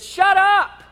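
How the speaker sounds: high, loud sharp command; commanding rebuke. Retold or imitated. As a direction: shout the storm into silence. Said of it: "he says".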